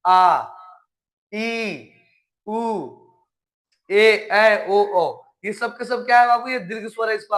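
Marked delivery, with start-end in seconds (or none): 0.1-0.5 a loud, drawn-out आ; long vowel demonstration. Shown as a demonstration. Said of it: "दुगुना समय लगता है". 3.9-5.2 ए, ऐ, ओ, औ loud and stretched; drilled long vowels. Shown as a demonstration. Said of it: "दुगुना समय लगता है".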